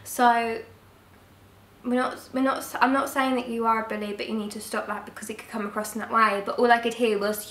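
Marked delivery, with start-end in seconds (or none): none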